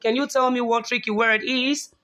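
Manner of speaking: ordinary talk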